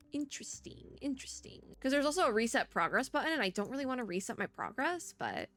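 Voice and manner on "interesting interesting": whispered to oneself, pondering; musing whisper. Real, not acted. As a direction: think aloud in a bare whisper. Musing to self over the puzzle.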